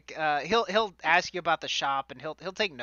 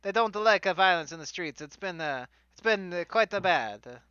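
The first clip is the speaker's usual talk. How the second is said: hammed-up fantasy Italian accent; bad stage Italian for a fantasy character; fake Italian accent. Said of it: "bad, fake fantasy Italian accent".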